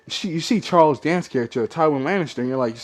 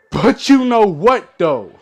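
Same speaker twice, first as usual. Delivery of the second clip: higher, louder and slow; emphatic repeat; 6 semitones higher, 7 dB louder, slower than usual. Shown as a demonstration. Vlogger sets up his complaint to viewers, emphatic. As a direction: lean hard into the question. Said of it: "first said plainly".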